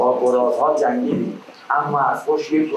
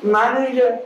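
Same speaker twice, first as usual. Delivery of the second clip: high, far-carrying call; 11 semitones higher, slower than usual. Acted. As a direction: call out across a distance. Calling out the names again.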